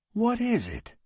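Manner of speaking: high-pitched, mildly weary question; tired. A narrator's reading. Old shopkeeper facing an unwelcome customer, mildly tired. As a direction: ask with a touch of weariness. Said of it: "he asked tiredly".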